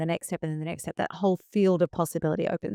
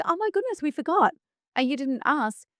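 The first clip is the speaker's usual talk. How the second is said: much higher mock-surprised gasp of realisation; surprised convener; 9 semitones higher than usual. Convener realising to the speaker that she forgot the fee, much higher and surprised. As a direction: play the convener realising the slip. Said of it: "And she's like".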